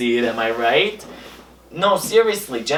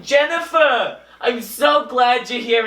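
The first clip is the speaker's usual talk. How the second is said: high, excited gush at a celebrity; gushing greeting; 8 semitones higher than usual. Retold or imitated. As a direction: squeal her name with delight. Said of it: "And I would be like".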